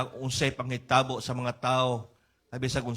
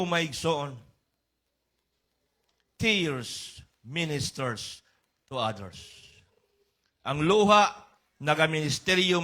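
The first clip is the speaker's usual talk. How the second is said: normal talking voice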